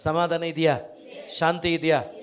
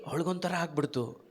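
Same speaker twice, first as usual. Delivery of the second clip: ordinary talk, nothing marked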